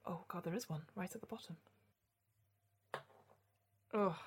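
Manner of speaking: hushed gasping nerves; frightened whisper over a shaky hand; adrenaline shakes. Real, not acted. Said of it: "My hands are shaking so much".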